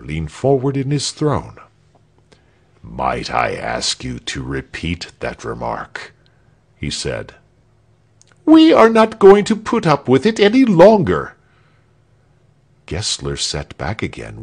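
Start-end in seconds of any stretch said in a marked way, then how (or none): 2.8-6.1 low, quiet, icy politeness; coldly menacing governor. A narrator's reading. Tyrant coldly challenges the defiant man. 8.4-11.3 high, loud rebellious protest; defiant shout. A narrator's reading. Rebel repeats his shouted defiance.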